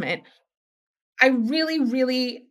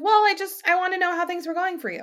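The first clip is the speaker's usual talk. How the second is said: high, hesitant other person; 9 semitones higher than usual. Retold or imitated. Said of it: "they're like".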